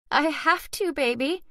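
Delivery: a mother's voice cracking as she soothes; tearful pleading to a child; voice breaking. A narrator's reading. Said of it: "my own voice breaking".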